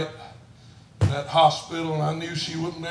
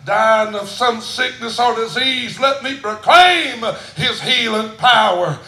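much higher and louder, slow start; shouted proclamation; 8 semitones higher, 10 dB louder than usual. Acted. Preacher proclaiming healing power, full shout. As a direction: proclaim it like a final cry.

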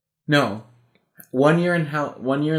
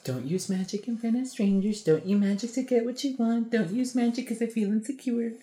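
high, soft put-on voice; mock nagging mom; 7 semitones higher, 4 dB quieter than usual. Mom laying down her rules about magic, mock nagging. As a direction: recite the rules in a higher, softer voice.

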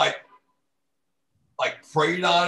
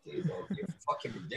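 whispered scolding; 14 dB quieter, much faster than usual. Retold or imitated. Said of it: "I'm like".

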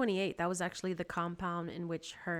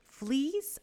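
startled cry, voice jumping up; cried out; 8 semitones higher, 6 dB louder than usual. A narrator's reading. Corrie finds fleas in the straw, cried out. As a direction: cry it out in alarmed disbelief. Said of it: "I cried".

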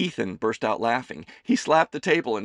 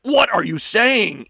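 high, loud, slow outraged shriek; 13 semitones higher, 11 dB louder, slower than usual. Acted. Son shrieking at his mother's confession. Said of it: "Ethan shrieked".

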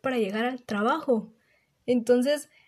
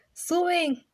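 retold shout; 7 semitones higher than usual. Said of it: "me tuve que armar de valor y gritar".

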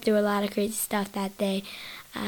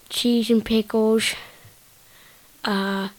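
usual speaking voice

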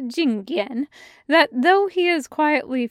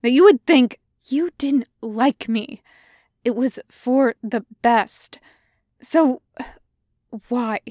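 hurt; 2 semitones higher, 6 dB louder than usual. Acted. Xiao Shu pressing emotionally.

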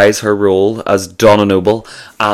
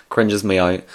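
normal talking voice

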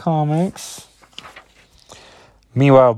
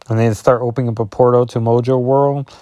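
usual speaking voice

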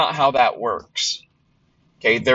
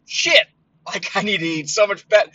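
own past reaction; 8 semitones higher than usual. Retold or imitated. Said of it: "for that to me goes".

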